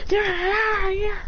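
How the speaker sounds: high, wailing mock battle cries; attack cry. Animals killing all the soldiers, acted cries.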